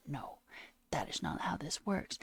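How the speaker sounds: breathless incredulous whisper. Real, not acted. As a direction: hiss it out in disbelief, nearly silent. Rant, whispered then shouted.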